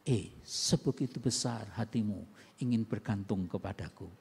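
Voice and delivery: God admiring the pleading believer, low and tender. Acted. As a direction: speak as a proud, gentle father. God voiced as proud, tender.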